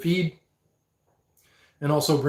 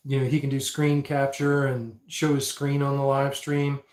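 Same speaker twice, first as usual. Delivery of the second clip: normal talking voice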